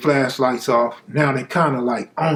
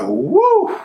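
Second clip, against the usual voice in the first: very high, loud whoop of relief; relieved whoop; 4 dB louder than usual. Retold or imitated. Suspect whooping after the witness clears him, high and loud. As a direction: let out a joyful woo.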